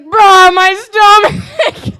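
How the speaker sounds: high, loud, drawn-out wail; mock agonized howl. Imitating friend five minutes later, howling in pain. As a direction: howl it slowly as a comic cry of pain.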